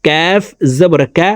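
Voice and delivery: কাফ made just forward of the tongue root; touch the palate for কা slightly ahead of the root. Shown as a demonstration. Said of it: "জিব্বার গোড়া থেকে একটু আগে বাড়াইয়া".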